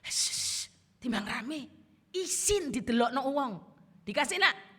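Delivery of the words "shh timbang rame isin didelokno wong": loud hushed mother, shh then scolding; hushing mother. Retold or imitated. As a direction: hush the child so people won't stare. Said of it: "Kasih apa ndak?". (ibu menyuruh diam anak yang rewel, berbisik keras lalu menegur)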